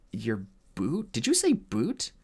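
disbelieving, voice jumping up on boot; can't believe what he heard; incredulous. Acted. Incredulous question.

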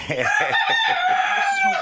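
very high, slow drawn coo. Real, not acted. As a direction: stretch it out sweetly and high. Cooing to newborn animal.

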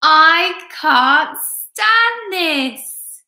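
very slow, high, loud wail; exasperated bear. A narrator's reading. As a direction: stretch every word in storybook despair. Bear kept awake again.

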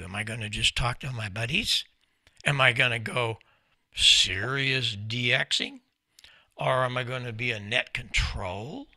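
normal talking voice

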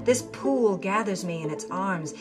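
plain reading voice

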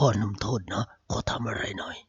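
low whisper kept secret. Acted. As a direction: whisper so the guards don't hear. Hostage speaks secretly.

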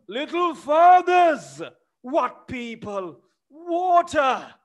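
high, loud wailing cry for help; panicked old servant. Acted. The frightened old servant crying out for help and water. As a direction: wail that you cannot breathe.

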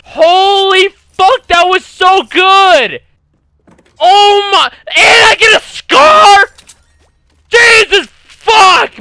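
high, loud, slow hyped yells; overwhelmed with excitement, let it rip; ecstatic shouting. Real, not acted. Hyped fan hearing the song.

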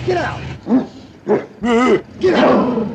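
high, loud barked command; sharp yell. Acted. Steve yells at the dog to get out, sharp and loud. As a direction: snap it out to chase the dog.

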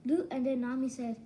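normal talking voice

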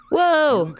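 sudden high startled whoa. Real, not acted. Streamer startled by her game, yelping whoa. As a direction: jumps at a sudden noise.

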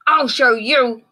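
loud high huffy shout; grouchy ladybug shout. A narrator's reading. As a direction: huff it out as you leave. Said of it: "screeched the great grouchy ladybug".

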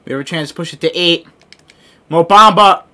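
ordinary delivery

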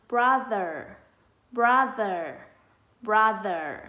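"brother" said loud and clear, falling at the end; phát âm mẫu. Shown as a demonstration. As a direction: model "brother" slowly for children to copy. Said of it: "Mời các con cùng thực hành từ". (đọc mẫu "brother" to, rõ, xuống giọng cuối từ)

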